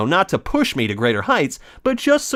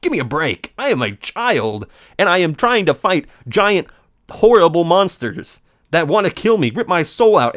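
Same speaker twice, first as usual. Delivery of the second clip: usual speaking voice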